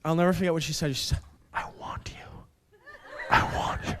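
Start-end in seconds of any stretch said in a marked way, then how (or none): 1.5-3.9 soft breathy whisper. Retold or imitated. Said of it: "she whispers".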